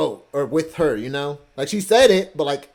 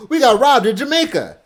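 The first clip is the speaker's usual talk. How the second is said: high, booming announcement; loud exclamation; 10 semitones higher, 9 dB louder than usual. Real, not acted. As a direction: shout it out as the big headline. Repeats headline of story.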